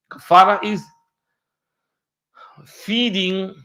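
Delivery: normal talking voice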